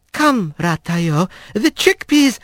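lightly voiced squirrel, slow then lifted; a hint of a fussy friend steering Rat away; squirrel speaking Manx. Alphonse coaxing Rat away from the booth, lightly voiced. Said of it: "and said in Manx".

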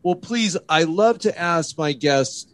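normal talking voice

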